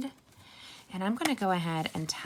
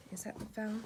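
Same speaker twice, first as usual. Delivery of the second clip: muttered to herself; 3 semitones higher, 9 dB quieter than usual. Real, not acted. Muttering about the dropped card.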